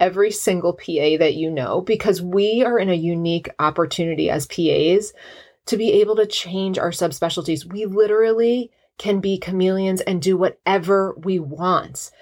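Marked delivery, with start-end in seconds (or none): none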